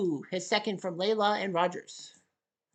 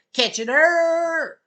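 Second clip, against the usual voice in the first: higher, louder, slow shout of Kitchener; hometown cheer; 6 semitones higher, 8 dB louder, much slower than usual. Acted. Announcer shouting the town name, higher, louder, slow. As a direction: yell the town name with pride.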